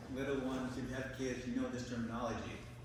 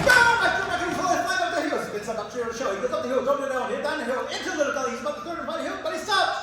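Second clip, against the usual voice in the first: very loud, high race narration; full-voiced, high-pitched blow-by-blow; excited storytelling shout; 14 semitones higher, 14 dB louder, faster than usual. A narrator's reading.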